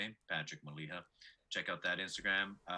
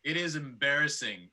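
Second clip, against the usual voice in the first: usual speaking voice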